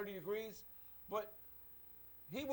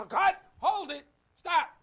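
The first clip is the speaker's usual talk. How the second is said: higher, louder yell to stop the take; director yelling cut; 9 semitones higher, 10 dB louder, slower than usual. Retold or imitated. Film director stopping the shot, loud mock shout. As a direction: bark it like a director halting the scene.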